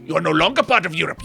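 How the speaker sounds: ordinary talk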